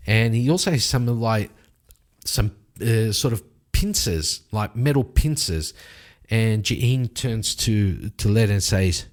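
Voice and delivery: ordinary talk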